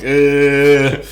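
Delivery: imitated noncommittal eh; reluctant hesitation. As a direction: reenact waving off a friend's suggestion. Friend's reluctant answer to recruiter invitation.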